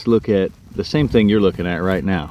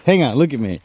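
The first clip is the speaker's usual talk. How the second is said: ordinary delivery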